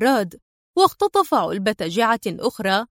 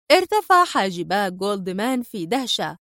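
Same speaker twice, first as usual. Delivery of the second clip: ordinary delivery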